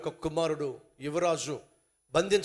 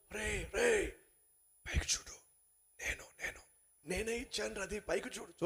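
soft, hushed urgent call from above; a hushed prince calling to the man below; 10 dB quieter than usual. Acted. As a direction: call down without being heard. Prince must not speak loudly.

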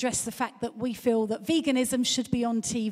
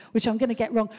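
usual speaking voice

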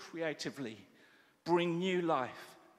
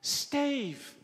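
high, louder call of his name from afar; calling across the road; 11 semitones higher, 4 dB louder than usual. Retold or imitated. A friend across the road calling out to Steve. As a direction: hail him from across the street. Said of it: "this call from the other side of the road".

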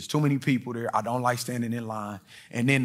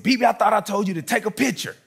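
upset scold; 7 semitones higher, 4 dB louder than usual. Retold or imitated. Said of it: "I said".

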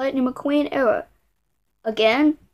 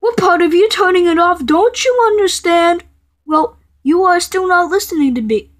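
loud, higher-pitched telling-off; angry scolding; 5 semitones higher, 6 dB louder than usual. Acted. Scolding someone who keeps turning the computer off, angry. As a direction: scold loudly in exasperation.

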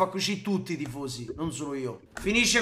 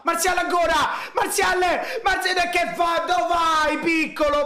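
excited shouting; 7 semitones higher than usual. Real, not acted. Shouting at Martial on screen.

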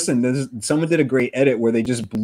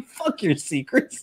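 mock wizard; 3 semitones higher, 6 dB quieter, slower than usual. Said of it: "It sounds like he's saying".

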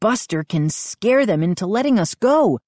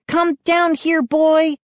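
high-pitched command to a dog; ordering; 12 semitones higher, 3 dB louder than usual. A narrator's reading. Mindy ordering the dog down, urgent command. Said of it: "Mindy ordered".

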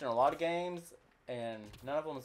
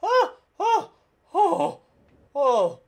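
high, loud panicked yelps; startled yelps; 14 dB louder, much slower than usual. Real, not acted. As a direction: yelp in real fright. Near fall off cliff, screaming.

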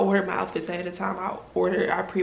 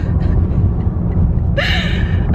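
shrieking laugh, pitched way up; squealing giggle; high squealing laugh; 8 dB louder than usual. Real, not acted. High laugh after joke.

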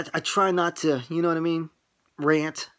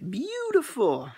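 high and stretched out slowly; movie line impression; 12 semitones higher, slower than usual. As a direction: float it up high, drawn out like the movie. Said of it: "like in Lethal Weapon four".

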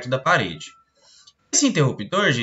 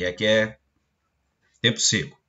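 ordinary talk

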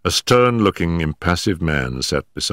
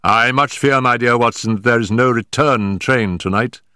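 higher, amused Holmes voice, dry; teasing Holmes; 7 semitones higher than usual. Acted. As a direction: Holmes mocking Watson's fate over the phone.

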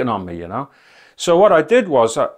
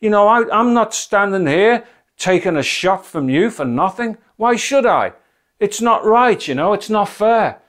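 high, raised, indignant protest; angry shouting; 5 semitones higher than usual. Retold or imitated. Man defying the devil in a field, indignant shouting. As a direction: shout it out as an unfair grievance. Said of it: "and I was shouting".

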